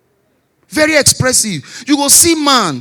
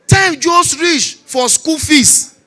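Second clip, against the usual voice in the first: normal talking voice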